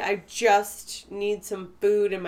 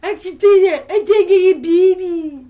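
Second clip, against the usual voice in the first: louder, higher babyish imitation with a laugh; baby talk; 6 semitones higher, 11 dB louder than usual. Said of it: "imitating her, imitating me".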